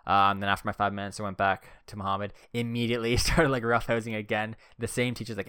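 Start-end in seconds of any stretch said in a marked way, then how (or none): none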